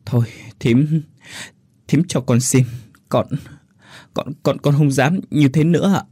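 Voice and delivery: a touch of tearful pleading; girl begs her aunt, lightly crying; crying while pleading. A narrator's reading. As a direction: beg through mild sobs, stammering. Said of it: "vừa nói vừa khóc".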